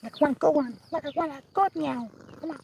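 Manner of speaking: very high, soft coaxing voice; pet talk. Real, not acted. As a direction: plead softly with the cat. Coaxing cat to meow.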